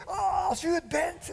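high, slow shout of doubt; slow doubtful shout. Acted. Peter calling back to Jesus from the boat, slow doubtful shout. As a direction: shout back, drawn out and unsure.

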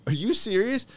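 high-pitched mock disbelief; incredulous reaction. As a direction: act out a stunned stranger being offered candy. Retold reaction to stall candy offer.

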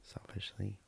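unvoiced, teasing whisper of selfishly; whispered aside. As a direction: whisper the word as a sly dig. Teasing jab at wife.

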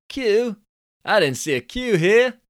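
high, loud Californian dude scoff. Acted. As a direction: squeak up the pitch like a cocky Californian. Billy voiced, Californian dude.

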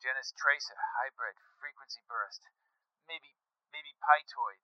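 soft, halting report over the radio; hushed and broken up; low voice. A narrator's reading. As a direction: speak quietly, piecing it together. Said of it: "he said in a low voice".

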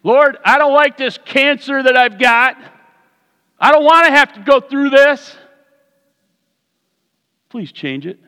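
high, loud pleading prayer; desperate plea. Retold or imitated. A sick believer pleading with God, high and loud. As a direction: beg God to change it. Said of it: "Anybody here ever say that?".

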